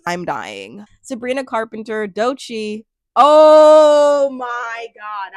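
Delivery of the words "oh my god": loud, very drawn-out exclamation; overjoyed fan outburst; excited gushing. Real, not acted. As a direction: stretch it out big, higher and much louder. Gushing over a favourite nominee.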